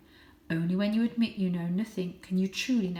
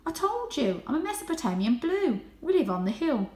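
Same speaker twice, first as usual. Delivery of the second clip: voiced high and light as a young cat; a proud little cat boasting; 7 semitones higher, 4 dB louder than usual. A narrator's reading. Young Varjak boasting his breed to the stray.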